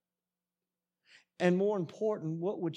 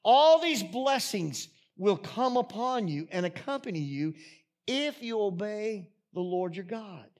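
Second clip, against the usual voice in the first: raised, emphatic preaching voice, easing at phrase ends; scripture read out with force; preached scripture reading; 6 semitones higher, 5 dB louder than usual. Acted. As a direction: declare the blessings to the congregation. Reads scripture aloud to congregation.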